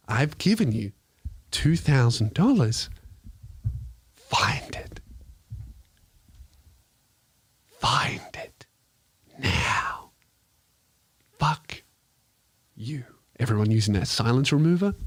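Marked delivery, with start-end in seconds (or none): 0.1-2.9 sweetly polite, like a call-centre rep holding back rage; polite customer service voice. Retold or imitated. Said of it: "my polite customer service voice". 4.1-10.1 whispered slowly, threat under the politeness; menacing whisper. Retold or imitated. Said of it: "my polite customer service voice". 11.4-13.0 soft polite curse. Retold or imitated. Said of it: "my polite customer service voice".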